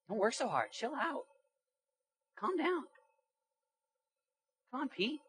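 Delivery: high-pitched coaxing to ease off; mock dissuasion. Imitates friends discouraging the zealous.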